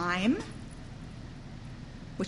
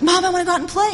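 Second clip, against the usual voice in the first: put-on childish plea; 8 semitones higher, 6 dB louder, much faster than usual. Child begging mother to play outside.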